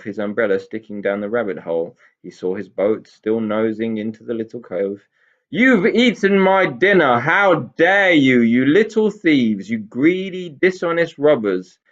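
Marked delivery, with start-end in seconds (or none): 5.5-11.8 furious roar. A narrator's reading. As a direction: bellow at the little thieves. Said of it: "roared Mister Hay there".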